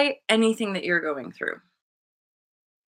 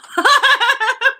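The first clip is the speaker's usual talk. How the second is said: high, loud cackling laugh; real burst of laughter; 13 semitones higher, 7 dB louder than usual. Real, not acted. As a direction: crack up at your own joke. Laughs at own remark.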